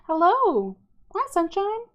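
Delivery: high-pitched singsong greeting; cooing greeting. Real, not acted. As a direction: greet a darling in a high, delighted coo. Breaks off reading to greet someone.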